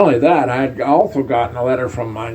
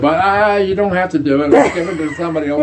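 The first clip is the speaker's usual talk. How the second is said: laughing while quoting; 7 semitones higher, 4 dB louder than usual. Retold or imitated. Retold captain offering to drop duty.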